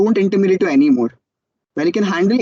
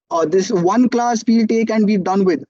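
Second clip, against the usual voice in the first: ordinary talk, nothing marked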